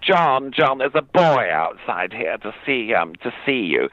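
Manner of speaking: plummy actress calling through the house; posh lady calling. Retold or imitated. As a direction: sing out for John in a grand actress voice. Actress calls to John.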